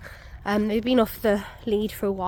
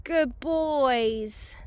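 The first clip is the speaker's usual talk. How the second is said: high, drawn-out pet praise; fond praise; 4 semitones higher than usual. Real, not acted. Owner to dogs who came back, fond cooing praise. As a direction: coo it to the dogs, gliding down from high.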